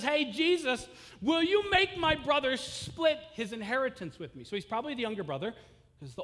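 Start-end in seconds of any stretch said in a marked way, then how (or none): 0.0-4.4 higher, louder call from the crowd; man in the crowd calling out to Jesus, higher and louder. Retold or imitated. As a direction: shout up to the teacher over the crowd.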